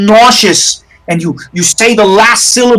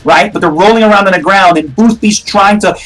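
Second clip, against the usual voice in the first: normal talking voice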